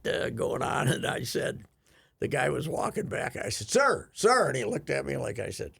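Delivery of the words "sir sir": raised, higher voice to hail someone; retold hail, pitched up and louder; calling out. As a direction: call after a passerby, imitated. Retold calling after a passing man.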